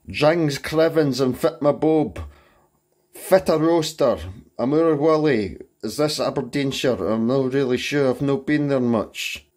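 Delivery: high, loud mock-Aberdeenshire Scots; faux Scottish accent. Shown as a demonstration. Presenter recording vocal performance three in a fake Scottish accent. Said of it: "that faux Scottish accent".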